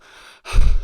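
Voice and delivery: heavy, breathless exhale after talking fast; out-of-breath sigh. Real, not acted. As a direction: blow out a loud, winded sigh. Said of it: "I'm out of breath".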